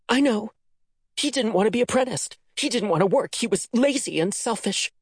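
high, bitter spitting of the words; spat words. A narrator's reading. Daughter spitting the community's lies at her mother. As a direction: spit the lies she was fed. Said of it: "Mercy spat the words".

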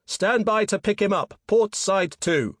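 high, loud deck command; yelled order. A narrator's reading. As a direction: call the order out across the deck. Peter yelling orders on deck.

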